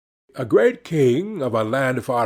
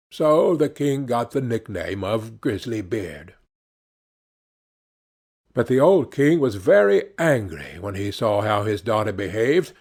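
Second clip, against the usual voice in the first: usual speaking voice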